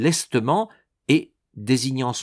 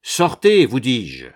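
insistent command; 7 dB louder, slower than usual. A narrator's reading. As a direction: insist loudly on it. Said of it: "insistait l'exempt".

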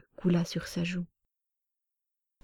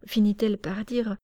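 ordinary delivery

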